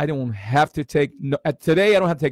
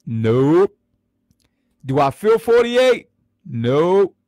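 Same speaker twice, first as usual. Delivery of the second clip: playful proud denial; 2 semitones higher, 6 dB louder, much slower than usual. Real, not acted. Boasting about looking young.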